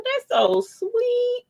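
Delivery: slow and way up high; slow high sweet; high touched drawl. Real, not acted. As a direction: melt over it.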